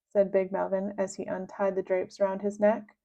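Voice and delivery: ordinary delivery, nothing marked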